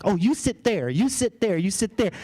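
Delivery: a mock bossy lady, pitched high; controlling woman. Woman in jacuzzi ordering the speaker around, bossy. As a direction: hand out seats like you own the jacuzzi. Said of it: "real controlling over the space".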